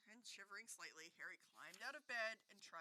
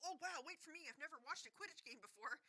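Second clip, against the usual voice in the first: higher, keen fanboy; eager kid voice; 7 semitones higher than usual. A narrator's reading. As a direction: chase after him, thrilled. Colin eager.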